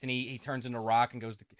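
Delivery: ordinary talk, nothing marked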